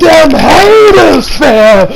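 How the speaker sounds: loud, high, drawn-out holler; roaring reaction to a savage comeback; hyped-up outburst. Real, not acted. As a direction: whoop it out slowly and loudly.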